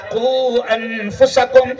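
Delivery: slow, drawn-out Arabic recitation; قرآنی آیت کی دھیمی تلاوت; Quran recitation. Acted. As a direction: intone the verse with reverence.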